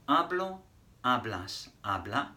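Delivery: "Hablo, hablas, habla" said clearly for repeating; teacher model. Shown as a demonstration. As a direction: model each form for the class. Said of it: "Repeat that after me".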